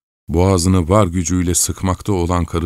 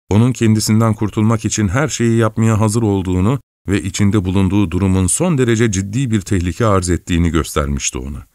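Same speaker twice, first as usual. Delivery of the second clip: ordinary delivery, nothing marked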